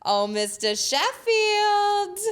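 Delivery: high, very slow Nanny call, drawn out; Fran Drescher impression. Showing how the Nanny says it, high and very slow. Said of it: "She goes".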